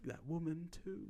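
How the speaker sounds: put-on female voice; imitated woman. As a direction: lightly imitate her, slow and high. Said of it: "she's like".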